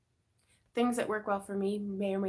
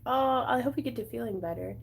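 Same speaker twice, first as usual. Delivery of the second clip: normal talking voice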